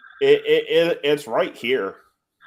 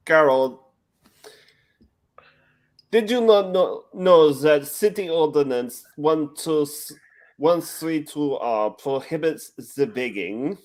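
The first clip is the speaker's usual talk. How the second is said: bad, hammed-up French accent, higher pitched; terrible French accent; 5 semitones higher, 3 dB louder than usual. Quoting Pink Panther to stream friends, in a clumsy French accent. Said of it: "trying to do a terrible a-, French accent".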